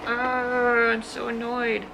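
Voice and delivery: lightly voiced annoyed grumble; a hint of annoyed whining; mock annoyed. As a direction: play the fed-up ex, half-hearted. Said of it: "And you're like".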